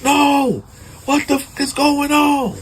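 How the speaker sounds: high frantic screaming in shock; anguished scream. Retold or imitated. Man at the crime scene screaming in horror. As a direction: scream it out like your family's just been found dead. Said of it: "He loses it".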